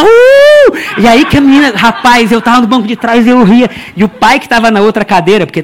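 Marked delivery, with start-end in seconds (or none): 0.0-0.8 very high loud mocking squeal; cheeky kid's squeal of mockery. Girl mocking mother.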